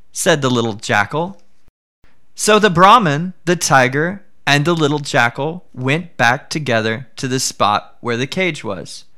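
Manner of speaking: ordinary delivery, nothing marked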